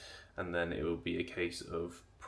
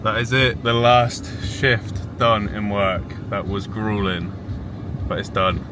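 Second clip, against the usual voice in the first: excited; 2 semitones higher, 9 dB louder than usual. Real, not acted. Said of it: "I'm excited".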